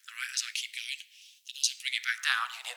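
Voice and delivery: thin, faint EQ-filtered voice; bass cut by EQ. Shown as a demonstration. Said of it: "getting lighter and higher and higher".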